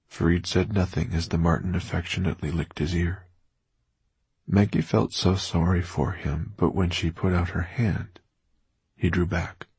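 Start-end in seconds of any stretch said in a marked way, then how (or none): none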